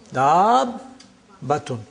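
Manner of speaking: "Dabbatun" as long daab, a pause, then batun; split at the sukoon. Shown as a demonstration. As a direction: break "Dabbatun" slowly between the two b's.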